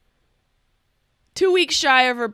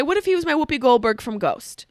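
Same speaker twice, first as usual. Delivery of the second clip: ordinary talk, nothing marked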